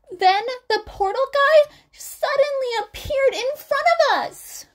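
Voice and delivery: put-on high, loud frightened girl; high panicked young girl; scared girl voice. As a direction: squeak it out like a terrified schoolgirl. Hagakure continues.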